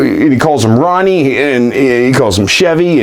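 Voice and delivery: ordinary talk, nothing marked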